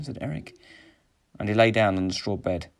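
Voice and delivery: ordinary delivery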